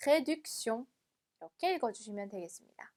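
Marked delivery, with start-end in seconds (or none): 0.1-0.9 "réduction" in one connected piece; joined up. Shown as a demonstration. Teacher putting the hard word back together, "réduction" run together as one word. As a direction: say "réduction" smoothly without breaks between syllables. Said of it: "붙여서".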